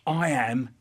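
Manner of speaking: normal talking voice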